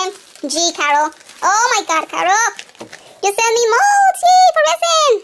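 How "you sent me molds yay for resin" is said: high, loud thrilled squeal of yay; gushing over a gift she loves; excited squeal. Real, not acted. Unboxing resin molds from Carol, squealing with delight.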